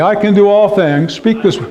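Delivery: rising high, ringing confession of faith; leading the church in spoken declaration; declaring scripture. Shown as a demonstration. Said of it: "do this with me, speak this".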